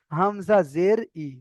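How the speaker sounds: slow, deliberate letter-by-letter recitation; measured spelling-out drill. Shown as a demonstration. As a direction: spell out "Hamza জের ই" unhurriedly. Teacher demonstrates spelling-out recitation.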